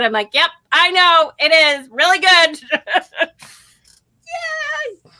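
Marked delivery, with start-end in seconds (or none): 0.3-2.6 higher, louder, ending in a laugh; gleeful agreement. Retold or imitated. Answering the boss's congratulations, gleeful. 4.3-5.1 tiny high-pitched "yay"; soft squeal of celebration; little cheer. Real, not acted. Celebrating having quit her job, quietly gleeful.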